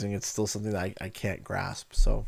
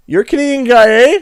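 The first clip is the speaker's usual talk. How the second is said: high, loud incredulous question; startled recognition; 10 semitones higher, 10 dB louder than usual. Retold or imitated. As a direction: imitate a rival realising who he faces. Rival recognises him at tournament.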